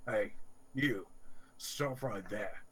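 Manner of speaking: a mild guard's call; bugbear guard yell. Acted. Bugbear guard stops travellers at the village gate, mildly yelling. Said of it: "One of the bugbears yells".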